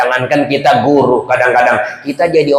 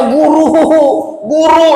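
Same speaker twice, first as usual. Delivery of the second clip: high, acted call for help; crying out; 11 semitones higher than usual. Dragged pupil calling his teacher, high acted cry. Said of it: "Sambil teriak dia".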